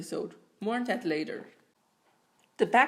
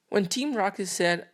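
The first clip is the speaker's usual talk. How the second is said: ordinary talk, nothing marked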